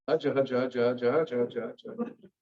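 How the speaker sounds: rapid mumbled prayer gibberish; mock pious praying. Boy showing off piety in waiting room, mock praying. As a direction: mumble a low, rhythmic imitation of fervent davening.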